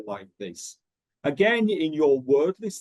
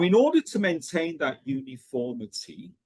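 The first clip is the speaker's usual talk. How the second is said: ordinary talk, nothing marked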